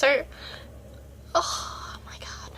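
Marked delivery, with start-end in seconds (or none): 1.3-2.5 slow whispered oh my God, overcome; choked whisper. Real, not acted. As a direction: whisper it as the tears win. Overcome by emotion mid-rant.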